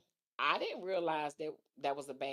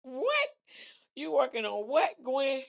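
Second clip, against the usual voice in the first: high, loud disbelieving "What?"; half-laughing disbelief, louder and higher; shocked; 8 semitones higher, 8 dB louder than usual. Real, not acted. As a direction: yelp of real surprise at the comment. Shocked reaction to the viewer comment.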